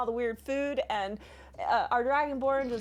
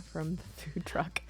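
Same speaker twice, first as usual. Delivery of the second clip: whispering; 5 semitones lower, 8 dB quieter than usual. Real, not acted. Still whispering after quote.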